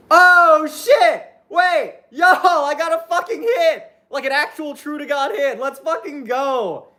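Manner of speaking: high, elated outburst, drawing out the words; real excited yell. Real, not acted. Streamer pulls a rare card on stream, thrilled outburst. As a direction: burst out thrilled at a lucky find.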